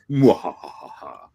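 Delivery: slow evil cackle; drawn-out villain laugh; mad scientist. Acted. Villain laughs at her victim.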